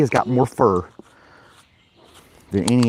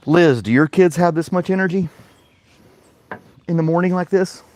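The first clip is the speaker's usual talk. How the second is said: exasperated call; 8 semitones higher, 5 dB louder than usual. Real, not acted.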